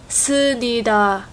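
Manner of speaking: "Sunida" slowly, with the m left out; slow Chinese learner's version. Retold or imitated. Repeating the Chinese learners' error slowly: "Sunida" with no m. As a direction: drag out "Sunida" and drop the m. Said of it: "the Chinese will say".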